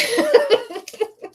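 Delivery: high, bright burst of laughter. Real, not acted. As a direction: laugh high at your own cheek. Laughs at own defiance.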